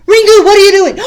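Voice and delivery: loud, high shout of the name; startled shout. Acted. Batu catching Ringu in the schoolroom, shouting. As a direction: catch him red-handed.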